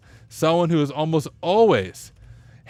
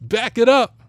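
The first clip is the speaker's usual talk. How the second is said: meme video quote; 5 semitones higher, 4 dB louder than usual. Retold or imitated. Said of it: "You guys remember that video?".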